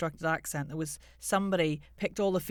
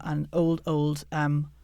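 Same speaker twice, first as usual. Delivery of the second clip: ordinary talk, nothing marked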